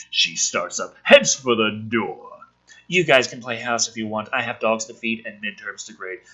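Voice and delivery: low, subdued screenplay read-through; script reading. Acted. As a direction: read the scene in a low, dry voice. Reads the script aloud.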